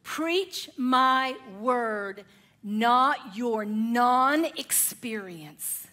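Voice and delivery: very slow, weighty divine command; slow, grave voice of the Lord; voice of God. Acted. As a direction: give each word as a solemn decree. And the Lord said to him.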